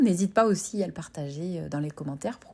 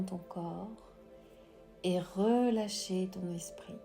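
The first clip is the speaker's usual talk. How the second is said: soft, slow whispered meditation voice; guided meditation; 12 dB quieter, slower than usual. Acted. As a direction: guide the breathing gently, very slowly. Leads a breathing meditation.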